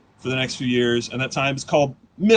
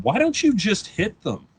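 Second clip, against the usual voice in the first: higher, slower fatherly line; dad's casual suggestion; 7 semitones higher, slower than usual. Retold or imitated. Dad suggests fighting back, mildly higher. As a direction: offer it like it's obvious.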